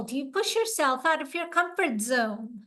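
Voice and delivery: ordinary talk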